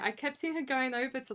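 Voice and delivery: ordinary talk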